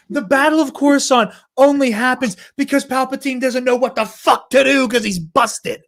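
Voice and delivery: normal talking voice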